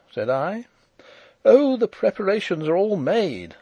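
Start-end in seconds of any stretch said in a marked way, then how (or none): 1.5-3.6 higher and louder, amused; laughing. A narrator's reading. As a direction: toss it off with a half laugh. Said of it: "laughed Fritz".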